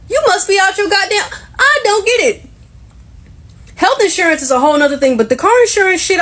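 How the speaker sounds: exasperated pitch climb. Real, not acted. Exasperated rant about car insurance.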